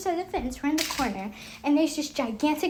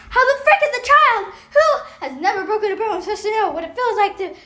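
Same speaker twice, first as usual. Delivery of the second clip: loud, higher-pitched exasperated rant; worked-up outrage; 6 semitones higher, 4 dB louder than usual. Real, not acted. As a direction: rant it, getting carried away. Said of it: "okay, let me calm down".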